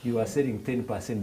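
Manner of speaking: normal talking voice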